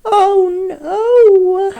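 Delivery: high, loud cry of alarm; loud high dismayed yelp; panicked cry. Acted. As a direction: shriek it in alarm. Character panics at hiccups.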